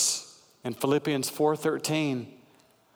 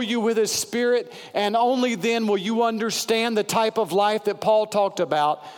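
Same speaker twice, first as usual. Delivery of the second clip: building sermon, pitched higher and rising; preaching; 5 semitones higher than usual. Acted. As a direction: lift the congregation toward the altar call.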